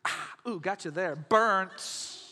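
smug gotcha, lightly voiced; a hint of a smug zinger; mock snark. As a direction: tease like a witty internet jab. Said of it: "who can be the wittiest".

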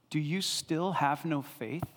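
soft, low, slow question; Jesus gently asking his disciples, soft and slow; gentle question. Retold or imitated. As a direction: ask it tenderly, no rebuke.

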